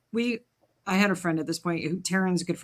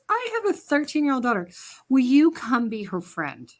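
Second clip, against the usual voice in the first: pleading mom voice; 7 semitones higher, 3 dB louder than usual. Retold or imitated. Mom asks girl to befriend.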